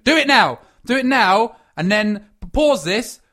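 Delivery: loud, high, insistent plug; urgent command. Pretend. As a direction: push listeners to act right now. Urging listeners to subscribe immediately.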